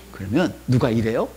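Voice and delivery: ordinary talk, nothing marked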